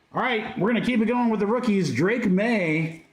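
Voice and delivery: ordinary talk, nothing marked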